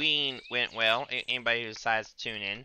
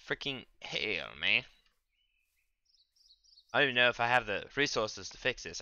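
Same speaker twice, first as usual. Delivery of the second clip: ordinary talk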